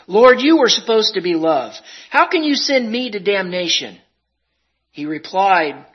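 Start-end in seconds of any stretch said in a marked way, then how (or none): 0.1-4.0 loud, high accusing cry; anguished protest. Acted. Condemned sinner crying out against the Lord, anguished. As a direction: hurl the question at him in anguish.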